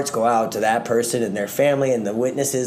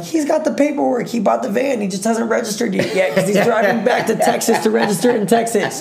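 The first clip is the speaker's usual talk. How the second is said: much higher, hurried mock-pleading mother; defending mom; 8 semitones higher than usual. Mom vouching for her son to sheriffs, in a much higher pleading voice. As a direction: plead his case like a frantic mother. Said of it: "My mom's like".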